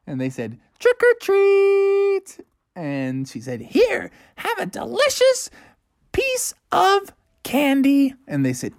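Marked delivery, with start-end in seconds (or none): 0.8-2.4 high, slow, drawn-out trick or treat; high, slow kid calling at the door; kid trick-or-treater. A narrator's reading. Skeleton kids at door. 3.6-8.1 high, slow doting granny; high granny handing out candy; sweet old lady. A narrator's reading.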